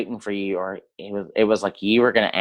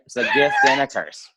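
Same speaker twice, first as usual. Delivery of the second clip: shouted much higher through laughter; laughing shout. Real, not acted. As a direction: crack up and yell the joke again. Repeats joke, laughing loudly.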